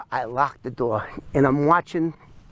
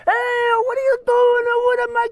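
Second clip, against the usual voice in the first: put-on very high, louder Italian-accented cry; mock Italian accent; 4 dB louder than usual. Wife seeing husband's car being taken, high mock Italian-accented shout. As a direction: imitate an alarmed Italian wife yelling. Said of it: "In a real Italian accent".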